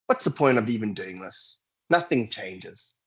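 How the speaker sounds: a hint of giving up, sighed out; resigned. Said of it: "resignation means".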